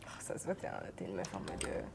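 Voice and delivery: whispered teasing aside; whispering. Real, not acted. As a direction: whisper it as if to yourself. Teasing aside while writing.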